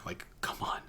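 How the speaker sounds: breathy whispered "come on"; whispered aside. Real, not acted. Reviewer whispering a sarcastic aside to viewers, whispered. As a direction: drop to a sly whisper.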